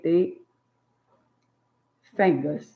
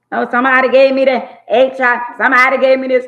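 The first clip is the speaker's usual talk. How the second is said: higher, much louder, fast whine; mock whining complaint; 7 semitones higher, 9 dB louder, much faster than usual. Mocking women who complain online, loud and whiny. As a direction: mimic a wailing woman online, hammed-up. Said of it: "talking about".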